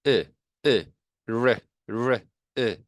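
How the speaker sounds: short, separate "에", then "레" with an English r onset; correct vowel demo. Shown as a demonstration. Teacher corrects the vowel for learners, short "에" and r-coloured "레". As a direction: isolate "에", add a retracted r for "레". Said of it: "요런 소리가 나야 돼요".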